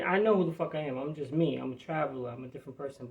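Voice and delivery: ordinary delivery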